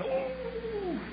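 soft, very high drawn-out "oh"; small high yelp of shock; high cold-water yelp. Retold or imitated. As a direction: squeal softly as if your toe hit icy water. Boy's toe touches cold water.

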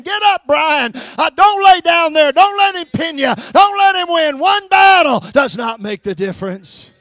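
loud, pressing exhortation; rising shouted plea not to give up; urging voice of the Holy Ghost. Retold or imitated. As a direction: shout the encouragement like a coach from the sideline. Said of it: "I hear the sweet Holy Ghost saying".

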